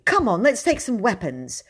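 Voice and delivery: put-on keen boyish excitement; excited. A narrator's reading. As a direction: urge a friend into the adventure. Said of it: "he said excitedly".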